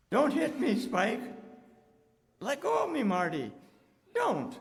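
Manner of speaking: high, loud panicked cries of don't; delirious terror. Acted. Delirious Jim crying out at his attackers, loud panicked pleading. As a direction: scream at attackers only he can see. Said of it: "I'm afraid he's delirious".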